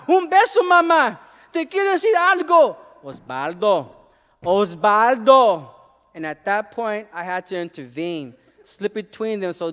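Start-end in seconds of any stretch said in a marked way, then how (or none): 0.1-2.8 put-on high, loud little boy begging; boy holding his mother's neck, pleading; clinging child's plea. 3.0-5.7 exasperated mother. Acted. Mother pulling away.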